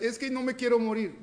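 high, loud pleading voice; hammed-up dread; mock fearful believer. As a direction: play a scared believer begging.

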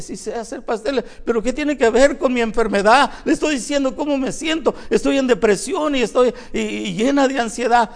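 high, loud, pleading complaint; troubled sister. Retold or imitated. Sick sister complains to her pastor, high and pleading. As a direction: plead your ailments to the pastor.

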